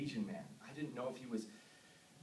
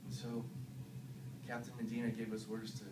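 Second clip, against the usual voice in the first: low, soft, haunted recounting; hushed confession; 7 semitones lower, 10 dB quieter than usual. Acted. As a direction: murmur it low and numb. Confessing killings of villagers.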